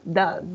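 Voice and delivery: does said "daz", vowel like a Spanish A; does with short a. Shown as a demonstration. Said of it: "se pronuncia como una A".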